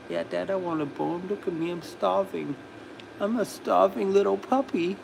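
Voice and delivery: soft, pleading little-puppy voice. Acted. Dog begs Dad for a bone, in soft puppy voice. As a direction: speak for a begging dog, small and hushed. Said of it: "It's like".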